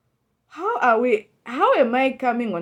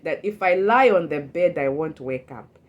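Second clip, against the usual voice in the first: normal talking voice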